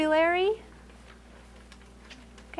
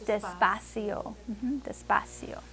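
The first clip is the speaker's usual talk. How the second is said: very slow despacio; 4 semitones lower, 7 dB quieter than usual. Shown as a demonstration. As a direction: draw out "despacio" slowly and softly. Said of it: "Más despacio is more slowly".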